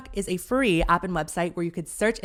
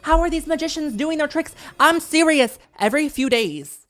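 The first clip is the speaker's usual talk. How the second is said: hammed-up indignant rant, rising higher and louder; mock outrage; 4 semitones higher, 5 dB louder than usual. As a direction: demand answers in exaggerated disbelief. Comic rant against magicians.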